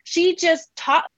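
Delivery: slow, hushed wonder, stretching each word; awed realization. Retold or imitated. Mom marveling to herself at her daughter, slow and awed. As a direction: savor the insight like a treasure. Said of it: "I just sat with it and I was like".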